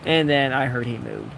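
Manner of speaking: ordinary talk, nothing marked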